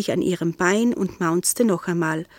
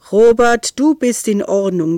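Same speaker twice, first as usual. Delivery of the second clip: delighted; 2 semitones higher, 7 dB louder than usual. A narrator's reading. Nero pleased.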